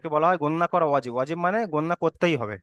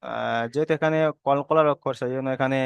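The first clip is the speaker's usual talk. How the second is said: ordinary talk, nothing marked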